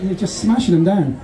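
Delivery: ordinary talk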